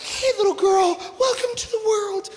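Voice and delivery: put-on high, tender baby-greeting voice; cooing new mother. Wife to newborn daughter, high tender cooing.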